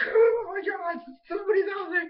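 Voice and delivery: high, loud, crying panic as Bolin; panicked squeal. Retold or imitated. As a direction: shriek it like a scared kid. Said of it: "then he's like".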